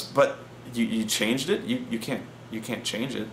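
low, soft, halting protest; retold hushed disbelief. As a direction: imitate a coordinator quietly taken aback. Coordinator taken aback by changed spells.

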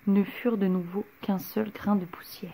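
hushed, breathless whisper; whispered narration. A narrator's reading. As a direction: whisper it like a secret.

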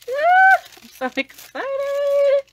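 very high, loud, slow squeal; excited squeal. Real, not acted. Seeing her dream palette, high delighted squeal.

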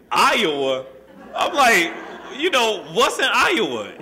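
can't believe it's Iowa; city boy stunned by the word Iowa; incredulous. Retold or imitated. Mom says he's moving to Iowa.

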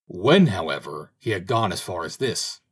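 ordinary delivery, nothing marked

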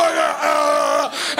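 throaty gargling noise; guttural choking demonstration; imitated gagging. TV doctor repeats her demonstration, gagging. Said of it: "deep into the throat and go".

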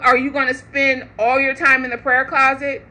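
ordinary talk, nothing marked

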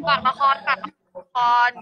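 loud sign-off plug; big loud send-off to viewers; calling out. Real, not acted. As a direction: call out the plug, last syllable loudest. Shouted sign-off plug for drama.

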